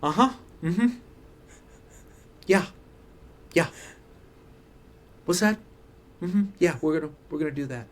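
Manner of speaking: lightly voiced stressed-out TV character; Jason Bateman. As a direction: murmur brief acknowledgements, stressed over morning coffee. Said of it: "Martin Bird stressed out morning coffee".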